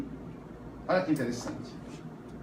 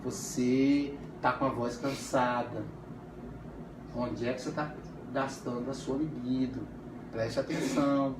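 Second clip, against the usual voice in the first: soft analyst voice; 8 semitones lower, 9 dB quieter than usual. Retold or imitated. Analyst addressing tired patient.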